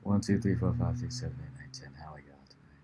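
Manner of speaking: very low, hushed voice; changed voice. Pretend. Counting for the host's mic test, in a changed low voice. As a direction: drop deep and soft for the mic check. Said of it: "I don't wanna change my voice anymore".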